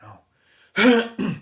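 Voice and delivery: a hammed-up, dragged-out wail of doubt; mock-dramatic loud slow moan; drawn-out mock wail.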